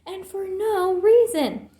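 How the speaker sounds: high, slow, tearful outburst; upset little cub crying; tearful anger. A narrator's reading. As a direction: sob out the grievance. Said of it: "she sobbed".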